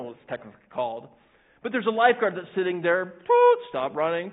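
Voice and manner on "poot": high sharp whistle toot; whistle blast. Retold or imitated. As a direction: mimic a lifeguard whistle. Lifeguard whistle imitated.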